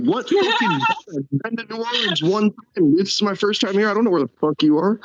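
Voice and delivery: mock outraged disbelief; exasperated. Retelling his reply to drunk friend, exasperated and high. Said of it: "I'm like".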